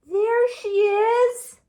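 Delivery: he thinks he has found mother; excited baby bird. Acted. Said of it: "said the baby bird".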